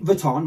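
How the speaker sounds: "Vuitton" as vuh-ton; a dull vuh opening on "Vuitton"; mispronounced Vuitton. Shown as a demonstration. Teaching what it is not, Vuitton as vuh-ton. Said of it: "It's not Vuitton".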